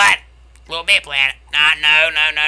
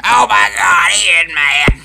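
loud, teasing exclamation; loud taunting; 3 semitones higher, 7 dB louder than usual. Real, not acted. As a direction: call out the rival's temper, loudly. Gleeful taunting of angry player.

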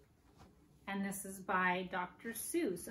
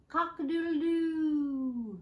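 high, loud cock-a-doodle-doo; rooster crow; 7 semitones higher, 6 dB louder than usual. Acted. Teacher crows like a rooster for the children. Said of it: "What does a rooster say?".

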